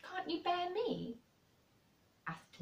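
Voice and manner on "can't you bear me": Mary's voice. Acted. Mary asks Colin a question.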